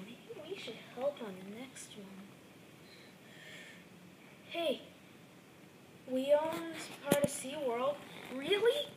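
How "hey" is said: normal talking voice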